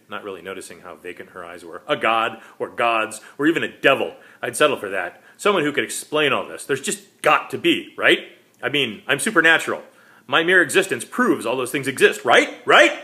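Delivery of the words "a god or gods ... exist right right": higher, louder, rising desperation; desperate plea. Acted. Vampire pleading louder and higher for an afterlife. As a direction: press her harder with every right.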